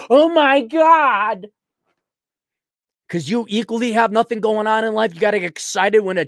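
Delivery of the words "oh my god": drawn-out, higher, louder mock "Oh my God"; exaggerated fake awe, stretched slowly; mock gushing gasp. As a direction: ham up a slow gushing gasp. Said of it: "Or are you just like".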